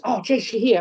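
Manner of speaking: lightly voiced boss, upbeat. Boss greeting a late wrestler at the door, upbeat. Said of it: "he goes".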